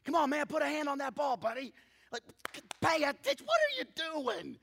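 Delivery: high, loud sports-dad shouting; re-enacted urgent coaching from a parent; passionate sideline yelling. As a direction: holler at the players from the stands. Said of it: "I'm passionate about my".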